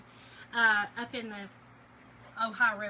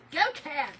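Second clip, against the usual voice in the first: louder, higher fan shout; cheering on the Cats; team cheer; 4 semitones higher, 8 dB louder than usual. Real, not acted.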